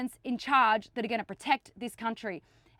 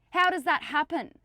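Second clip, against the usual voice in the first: louder outraged repeat; 6 semitones higher, 5 dB louder than usual. Real, not acted. Demanding answers from police, louder outraged repeat. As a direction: pitch up and push it louder, indignant.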